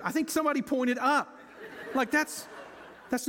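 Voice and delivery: real amused laugh while talking. Real, not acted. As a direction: chuckle at the crowd's mistake. Laughs at crowd pointing up.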